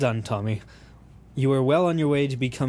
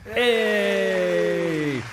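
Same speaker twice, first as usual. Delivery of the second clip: cheery hey; 7 semitones higher than usual. Acted. Cheery greeting in accent skit.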